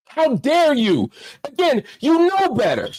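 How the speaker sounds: scolding a friend who let you down; indignant how dare you. Real, not acted. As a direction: throw the question back at him in disbelief. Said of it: "I probably sound like I'm mad".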